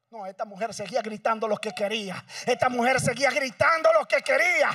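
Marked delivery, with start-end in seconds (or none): 2.5-4.7 the repeat said louder and higher; louder preaching. Shown as a demonstration. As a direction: lift the voice on the repeat. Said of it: "repeated louder". (dicho más fuerte y agudo)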